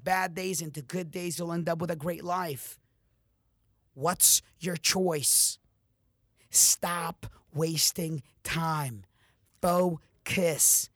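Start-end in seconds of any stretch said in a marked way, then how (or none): 3.9-10.9 slow, heavy and loud, one word at a time; slow commands. Real, not acted. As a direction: drive each command home slowly.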